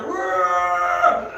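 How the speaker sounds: long roar from afar; answering holler. Acted. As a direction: holler back like a beast. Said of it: "hollering back at them".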